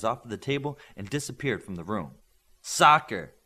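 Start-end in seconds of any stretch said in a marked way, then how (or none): 2.6-3.4 correcting. A narrator's reading. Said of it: "corrected Virtuoso".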